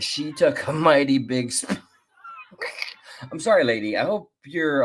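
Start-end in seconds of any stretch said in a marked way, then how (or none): 2.2-2.9 sudden high squeal of a laugh; burst of laughter. Real, not acted. Host mocking the woman's fall, squealing with laughter. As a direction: laugh breaks through mid-word. Host cracks up mid-sentence.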